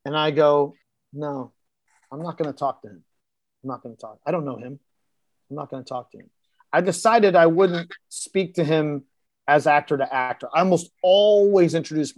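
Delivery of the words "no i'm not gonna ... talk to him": low mumbled inner monologue, much softer; muttered to himself. Retold or imitated. Actor to himself before the scene, softly muttering he won't talk. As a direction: mutter it under your breath, set on ignoring him.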